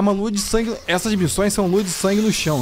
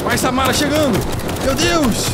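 scared horror game streamer; 9 semitones higher, slower than usual. Real, not acted. Monster attacking, cursing.

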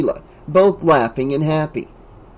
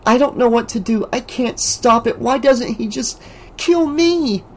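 high, despairing voice near tears; tearful; 9 semitones higher than usual. A narrator's reading. Alice tearfully telling Dan she can't stop it. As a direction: plead in helpless despair. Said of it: "Tears welled up in her eyes".

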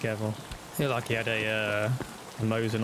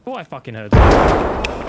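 loud exclamation; 14 dB louder, slower than usual. Real, not acted. Reacts to gunfire.

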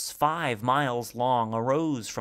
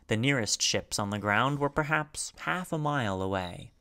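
usual speaking voice

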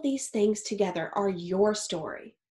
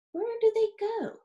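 wondering question; 12 semitones higher than usual. A narrator's reading.

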